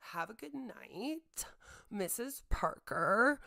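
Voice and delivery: slow, sugary mock-sweet goodnight; sarcastic goodbye to Missus Parker; sarcastic farewell. Real, not acted. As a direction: drip fake sweetness. Sarcastic sign-off.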